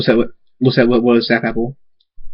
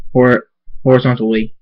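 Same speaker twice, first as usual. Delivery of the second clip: usual speaking voice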